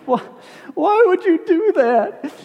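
high-pitched, drawn-out imitated wail; mock bawling. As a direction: imitate a tearful fiancee wailing in protest. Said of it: "starts bawling".

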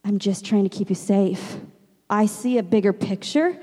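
calm, softened voice of a loving God; hushed, patient comfort; gentle reassurance. Acted. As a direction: answer a protest tenderly and quietly. God answering gently, set against pleading.

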